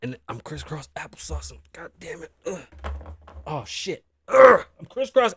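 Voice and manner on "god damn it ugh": usual speaking voice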